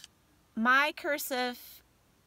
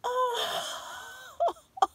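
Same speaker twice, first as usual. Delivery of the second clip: sharp high gasp, then a tearful high oh; emotional gasp; 4 dB louder than usual. Real, not acted. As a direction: gasp as the tears come. Opening gift, emotional.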